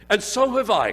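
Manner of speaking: loud, high eager cry; a boy crying out eagerly to his mother; cried out. A narrator's reading. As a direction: Peter cries out loud and high. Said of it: "cried Peter".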